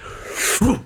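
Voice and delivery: sudden realizing gasp; sharp gasp. Retold or imitated. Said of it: "it came flying into my face".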